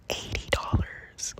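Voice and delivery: slow, breathy whisper; whispered price. Retold or imitated. The reader naming his fee, slow whisper. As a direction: whisper the price as if it's a secret.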